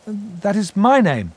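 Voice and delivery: high, slow, guarded reply; wary young Pip. A narrator's reading. Pip answers the stranger on the stairs, wary.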